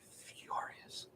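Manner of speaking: breathy whispered word; whispering. Real, not acted. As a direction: whisper it under your breath. Whispered aside about anger.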